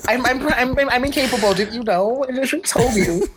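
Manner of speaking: high, whiny playful plea; mock helplessness. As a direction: ham up being incapable. Joking helplessness.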